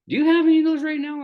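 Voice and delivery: high, loud eager question; mock excited waitress asking for patches. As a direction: she can't wait to get them.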